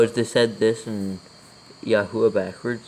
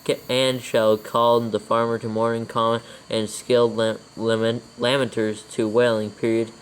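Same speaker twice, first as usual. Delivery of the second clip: dictating. Real, not acted. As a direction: read it out word by word for copying. Dictates scripture with punctuation.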